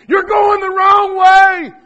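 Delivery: slow, high, loud yell; yelling to the lost. Retold or imitated. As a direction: call out slowly to the lost with full force. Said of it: "I'm yelling to the lost".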